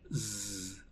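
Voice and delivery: low quiet buzzing zzz; soft buzzing z. Shown as a demonstration. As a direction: buzz softly like a bee. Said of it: "como una setita". (zumbido suave y grave de zeta)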